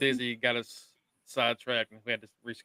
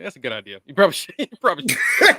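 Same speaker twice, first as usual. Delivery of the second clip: laughing; 3 semitones higher, 9 dB louder, faster than usual. Retold or imitated. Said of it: "And I was like".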